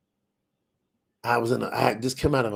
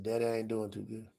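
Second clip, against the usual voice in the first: much softer, subdued confession; down and low; 5 semitones lower, 12 dB quieter than usual. Retold or imitated. Son quietly telling his daddy he's not okay, subdued. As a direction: say it quietly like you're barely holding on.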